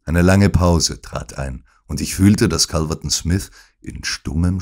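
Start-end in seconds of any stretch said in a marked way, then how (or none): none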